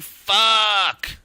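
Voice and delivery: imitating a frustrated player. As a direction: toss off a frustrated curse. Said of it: "Caliber saying".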